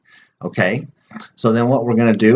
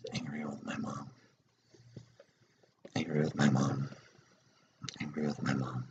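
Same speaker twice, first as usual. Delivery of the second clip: much softer and lower, fading toward a whisper; murmured tapping phrases; 7 semitones lower, 11 dB quieter than usual. Shown as a demonstration. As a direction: mutter the phrases quietly while tapping. Second round of tapping phrases.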